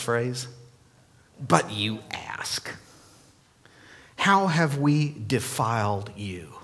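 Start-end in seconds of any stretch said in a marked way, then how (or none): 1.4-6.5 mocking. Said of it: "Malachi is mocking them".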